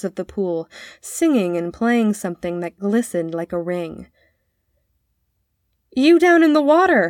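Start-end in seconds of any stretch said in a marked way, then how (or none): 5.9-7.1 high, loud hail to someone far below; calling out. A narrator's reading. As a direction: call down to people a long way off. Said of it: "he called".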